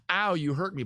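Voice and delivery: hurt. Acted. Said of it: "I'm aggressive to you".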